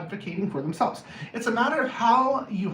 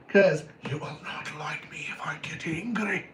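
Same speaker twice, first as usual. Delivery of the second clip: lower, hushed mock menace; whispered threat; 4 semitones lower, 5 dB quieter than usual. Warning someone who crosses the boundary, whispered mock threat.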